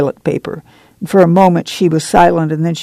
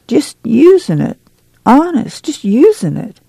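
high, pleading protest; flustered whine; 7 semitones higher than usual. A narrator's reading. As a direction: insist plaintively, voice rising well up. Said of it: "the flustered confessor".

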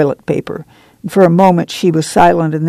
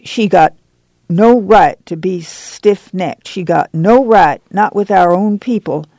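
ordinary delivery

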